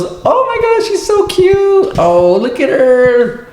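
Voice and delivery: put-on high, slow cooing old lady; high-pitched doting granny voice; old lady gushing. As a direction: gush over the cute kid like a doting old woman. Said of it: "And the old lady goes".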